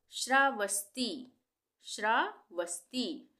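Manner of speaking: श्रावस्ती drawn out slowly, clear श्र; slow model word. Shown as a demonstration. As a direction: sound out श्रावस्ती for beginners. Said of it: "ये संयुक्त अक्षर है श्र". (श्रावस्ती धीरे बोलें)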